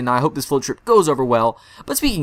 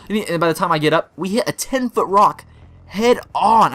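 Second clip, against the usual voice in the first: normal talking voice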